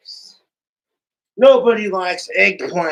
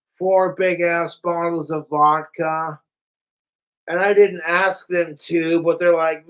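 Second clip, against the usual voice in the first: ordinary delivery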